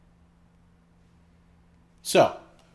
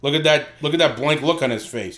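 brash mocking Bostonian; Boston accent; 4 dB louder than usual. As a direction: heckle in a broad Boston accent. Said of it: "do it with the Boston accent".

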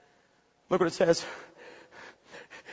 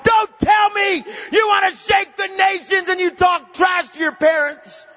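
full-force preaching; 4 semitones higher, 5 dB louder than usual. Real, not acted. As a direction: roar the challenge at the congregation.

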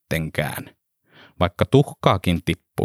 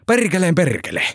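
a high shout of perkeleen perkele, perkeleen hit hardest; shouted curse; 9 semitones higher than usual. A narrator's reading. Truck driver shouts in delight at hearing Kuhmo. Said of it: "kuski huusi".

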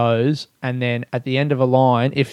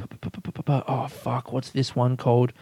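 ordinary talk

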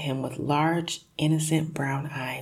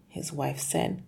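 ordinary delivery, nothing marked

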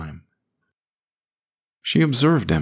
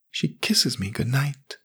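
put-on softer boyish voice; small boy, softly; 8 semitones higher, 5 dB quieter than usual. A narrator's reading. Jamie sullen.

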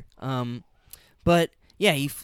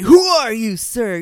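mock loud demand; 9 semitones higher, 12 dB louder, slower than usual. Acting out the phone confrontation.

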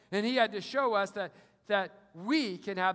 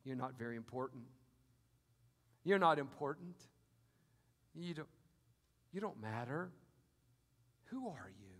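softer, lower, sly voice of the tempter; mock devil's whisper; 7 semitones lower, 6 dB quieter than usual. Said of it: "He whispers to you".

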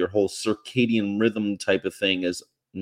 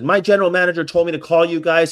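higher, louder retold excitement; imitated excited manager; 7 semitones higher, 6 dB louder, faster than usual. Manager coming out to the police, imitated excitement. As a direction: blurt it out, pitched up. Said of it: "like, really excited".

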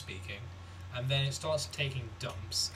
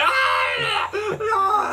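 high loud yelp of stinging eyes; sudden hammed-up cry of pain; mock pain yell; 11 dB louder than usual. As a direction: yell like shampoo burns.

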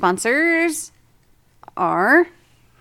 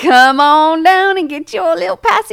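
ad announcer; 8 semitones higher, 8 dB louder than usual. Acted. Ad-style sponsor pitch.

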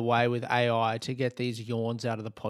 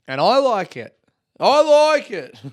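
playful exclamation; 9 semitones higher, 10 dB louder than usual. Real, not acted. Playful boast about the tea.

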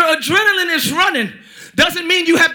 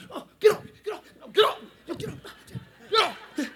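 softer, pleading get off, half-laughing; mock frightened protest; 9 dB quieter than usual. Preacher acting out a friend shoved in a fight, mock frightened. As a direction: play the pushed-off friend, mildly scared.

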